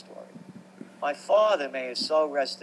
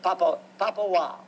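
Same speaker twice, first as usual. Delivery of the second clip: higher, fond calling out in father's voice; imitating father; 5 semitones higher than usual. Father in the car nicknaming his son, lightly imitated. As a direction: call the boy's nickname like his dad did. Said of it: "say in the dialect".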